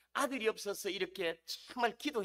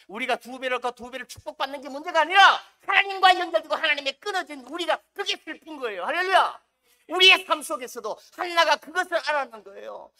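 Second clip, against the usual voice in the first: louder, higher impassioned preaching; fervent preaching; 5 semitones higher, 10 dB louder than usual. Acted. Pastor urging the congregation, fervent loud preaching. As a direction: build it like a revival pastor.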